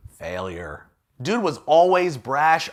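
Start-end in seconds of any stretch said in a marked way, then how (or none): 0.1-0.8 deadpan. Acted. Deadpan one-word aside.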